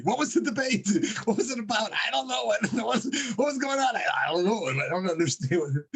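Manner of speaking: high, laughing imitation of a puzzled audience; baffled crowd. Joke teller voices the audience wondering about the debate, mock bewilderment.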